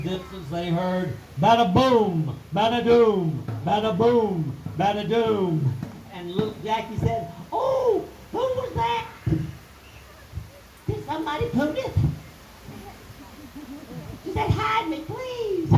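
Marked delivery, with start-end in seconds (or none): 1.4-5.7 loud booming stomp chant; giant footsteps. Acted. The giant stomps down the road, booming steps. 7.5-15.7 very high, frightened squeak; scared little voice. Acted. Jackie hears the giant coming and squeals in fright.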